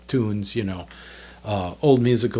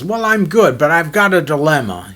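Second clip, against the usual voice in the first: higher, louder Bob voice, fussing over a problem; Bob with a dilemma; 4 semitones higher, 5 dB louder than usual. Retold or imitated. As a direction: make Bob sound grave about a trifle. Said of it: "He goes".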